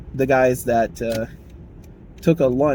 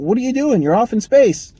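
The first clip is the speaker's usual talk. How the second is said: much higher, louder mock scold; scolding boss; 11 semitones higher, 7 dB louder than usual. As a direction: snap at the daydreamer. Manager scolding daydreamer.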